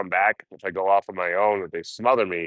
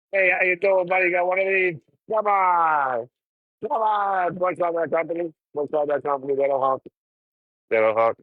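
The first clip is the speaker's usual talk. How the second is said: high, bright roadside hustle pitch, much higher; calling out to drivers; 11 semitones higher than usual. Acted. Hitchhiker hollering at passing drivers, high and pushy. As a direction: call out to passing cars like a hitchhiker.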